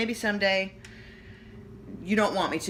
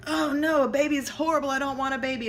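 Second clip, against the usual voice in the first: put-on higher, louder dismayed child; whiny kid voice; 9 semitones higher, 6 dB louder than usual. Cartoon kid dreading a new baby, whiny child voice. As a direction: play a kid horrified by a baby.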